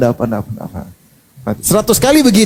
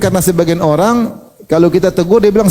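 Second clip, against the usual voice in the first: usual speaking voice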